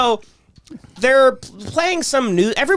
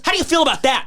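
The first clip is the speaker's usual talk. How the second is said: much higher, pointed question; mock confronting teacher; 9 semitones higher, faster than usual. Teacher confronts the mocking student, pointed. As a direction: lean in and needle him. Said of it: "he goes".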